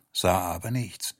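ordinary delivery, nothing marked

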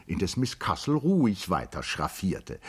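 ordinary delivery

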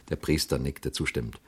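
ordinary delivery

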